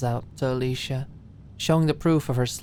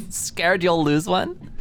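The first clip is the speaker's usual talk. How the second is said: teasing; 5 semitones higher, slower than usual. A narrator's reading. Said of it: "with a laugh".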